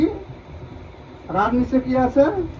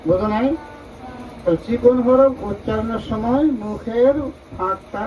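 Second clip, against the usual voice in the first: ordinary delivery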